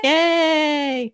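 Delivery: high, drawn-out happy cheer; celebratory whoop on "Yay"; excited cheer. Real, not acted. Cheering the new free course.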